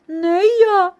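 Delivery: high, loud mouse squeak. A narrator's reading. Second mouse refusing to knead, squeaking. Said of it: "пищить Верть".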